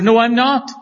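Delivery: mock-defensive [name]; [name] denying. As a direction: protest as a cornered man. [name] denying, acted quarrel.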